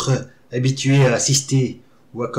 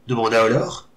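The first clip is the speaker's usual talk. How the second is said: plain reading voice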